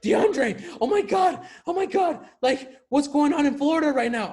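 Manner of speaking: high, frantic imitation of an anxious grandma; panicky grandma imitation. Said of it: "She'll call me randomly like".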